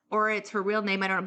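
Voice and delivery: usual speaking voice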